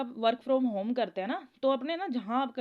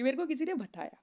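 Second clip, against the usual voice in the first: usual speaking voice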